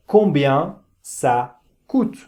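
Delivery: "combien ça coûte" said slowly and carefully; slow model phrase. Shown as a demonstration. Teacher models the phrase for learners. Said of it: "Por ejemplo".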